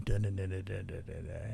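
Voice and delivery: very soft, low rapid babble; droning mock chatter. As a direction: babble it low under your breath. Mimics his droning yelling.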